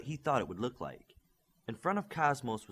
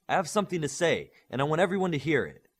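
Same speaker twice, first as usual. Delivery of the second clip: louder, higher and fast, forceful; 5 semitones higher, 6 dB louder, faster than usual. A narrator's reading. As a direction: push the words out hard and quick. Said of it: "Olympian said with force".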